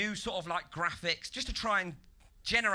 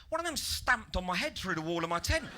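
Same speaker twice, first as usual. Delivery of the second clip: ordinary delivery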